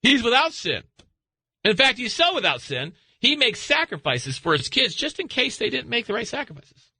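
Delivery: high, playful mock God voice; voicing God. God boasting to Lucifer about Job, in a high hammed-up voice. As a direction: ham up God bragging about his man Job, up high. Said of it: "He goes".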